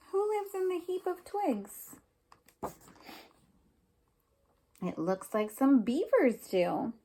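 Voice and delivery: lively picture-book read-aloud with questions; reading to children. Acted. Teacher reading a picture book to her class, lively. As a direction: read it to little kids, asking as you go.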